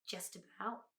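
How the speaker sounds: soft, modest-sounding pride; quietly pleased with himself; hint of pride. A narrator's reading. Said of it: "hint of pride in his voice".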